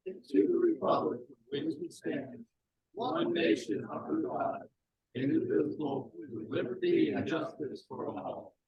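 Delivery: group recitation in measured phrases; formal pledge with pauses between phrases. Real, not acted. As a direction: lead a room in reciting a pledge. Leads the Pledge of Allegiance.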